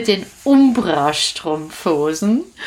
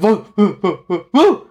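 dog barking; 4 dB louder than usual. Acted. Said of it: "Und die Hunde".

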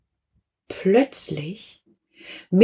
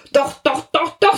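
rising, higher and quick insistence; insistent butcher; 9 semitones higher than usual. Acted. The butcher insists to the lawyer on the phone, higher. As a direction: insist over and over.

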